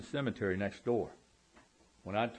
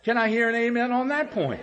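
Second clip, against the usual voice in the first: high, loud call to the congregation with a laugh; rally the room for an amen; 9 semitones higher, 7 dB louder than usual. Real, not acted. Calling on congregation.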